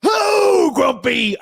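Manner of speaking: high, loud mocking yell; mocking shout. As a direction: yell the name up high to tease. Mock shouting at co-host.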